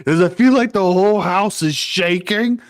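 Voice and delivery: high, hammed-up worried voice; mock alarm. Housemate hearing chaos in the basement, mock alarm. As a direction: play-act rising panic, stretching out the end.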